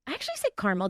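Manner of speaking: "caramel" said as CAR-mul; two-syllable caramel. Shown as a demonstration. Baker admitting how she really says it, "caramel" as CAR-mul. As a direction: drop the middle vowel of "caramel": KAR-muhl. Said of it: "I was trying to be proper".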